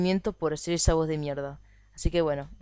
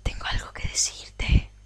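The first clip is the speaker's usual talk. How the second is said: secretive whisper. Acted. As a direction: lure them closer in a whisper. Personaje susurra para atraer al jugador. (susurro misterioso)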